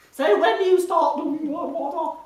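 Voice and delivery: high, loud breaking-up impression; Anne Widdecombe. Shown as a demonstration. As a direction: mimic a mobile phone with bad reception. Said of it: "She sounds like a mobile phone with bad reception".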